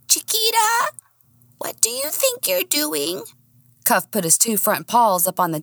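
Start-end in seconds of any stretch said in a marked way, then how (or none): 0.1-3.2 very high squeak, rising on Chiquita; squeaky dog voice. Acted. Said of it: "I'm gonna squeak".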